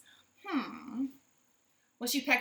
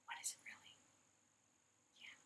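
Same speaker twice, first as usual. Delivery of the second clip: 1 whispered aside; 23 dB quieter, much faster than usual. Real, not acted. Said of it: "aside whisper".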